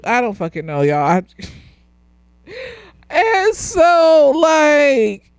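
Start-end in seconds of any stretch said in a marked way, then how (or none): 3.1-5.3 high-pitched, laughing through the words. Real, not acted. Podcaster losing it over her own plot confusion, laughing. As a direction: crack up while trying to go on.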